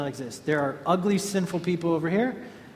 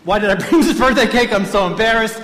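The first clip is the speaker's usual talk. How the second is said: mock embarrassed self; 4 semitones higher, 7 dB louder than usual. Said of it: "if it's me, I ask one person".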